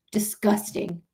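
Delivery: softly muttered disgust; low, under the breath; quiet disgust. Real, not acted. Streamer condemning treatment of immigrants, quiet disgust.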